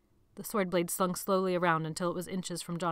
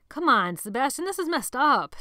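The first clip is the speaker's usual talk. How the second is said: louder, higher protest; arguing; 6 semitones higher, 7 dB louder than usual. A narrator's reading. Tony protesting to Sebastian about hurting Colin. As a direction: push back at him. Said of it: "Tony argued".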